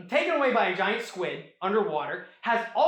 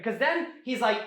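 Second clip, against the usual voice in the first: ordinary talk, nothing marked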